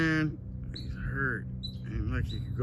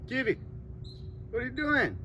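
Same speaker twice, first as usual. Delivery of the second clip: much higher, sing-song calling; high pet-talk voice; 12 semitones higher than usual. Real, not acted. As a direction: call out like to a kitty. Calling to the bobcat.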